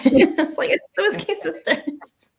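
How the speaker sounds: slow, high starstruck exclaim; a lightly voiced starstruck fan; fangirl gush. Excited fangirl quote.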